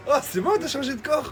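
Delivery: laughing. Real, not acted. Teasing friend, laughing.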